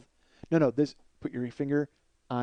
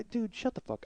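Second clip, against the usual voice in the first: high, soft, strained plea; hushed exasperation; 6 semitones higher, 11 dB quieter, slower than usual. Acted. To the stranger who keeps talking, hushed exasperation. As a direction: plead quietly for them to stop. Same plea, held back.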